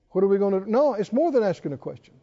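ordinary talk, nothing marked

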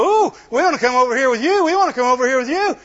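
high, fast, excited pleading; mock eager onlookers. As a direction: gush it quickly like a keen crowd wanting in. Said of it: "see people walking and go".

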